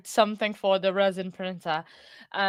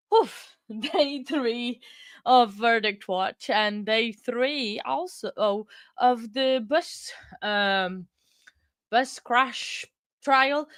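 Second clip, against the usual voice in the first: slow, drawn-out words; slow and tired; 2 semitones higher, much slower than usual. Real, not acted. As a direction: drag each word out wearily.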